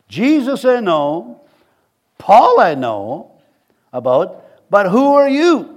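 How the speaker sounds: loud, drawn-out, high-pitched challenge; slow booming taunt, rising to a loud question; challenging evil spirit. Acted. Evil spirit answering the seven sons, loud slow challenge.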